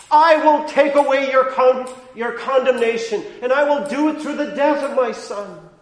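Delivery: loud, rising pulpit preaching; impassioned preacher; full-force preaching. Acted. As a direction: drive the law's demands home from the pulpit. Voices God's promises loudly.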